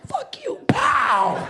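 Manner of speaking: hammed-up hang-up; mock phone slam. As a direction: snap it off like slamming the receiver. Mimes slamming phone on someone.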